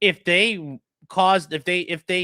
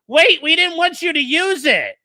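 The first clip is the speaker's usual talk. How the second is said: high, mock-whiny objection; exasperated mock quote; mocking protest; 7 semitones higher, 4 dB louder than usual. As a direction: imitate a feeble complaint, pitched up. Mimics lawyer's complaint in rant.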